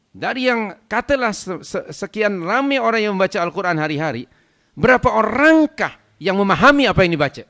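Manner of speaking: loud, high rhetorical questioning; impassioned preaching. Real, not acted. Preacher challenging listeners on understanding the Quran, impassioned preaching. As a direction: press the question on the congregation.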